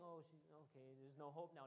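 mild mock despair; hopeless. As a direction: let the hope drain away.

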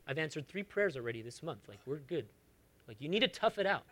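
quiet, low-key brush-off; softly fob them off, mock offhand; mock dismissive God. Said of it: "God doesn't talk like that".

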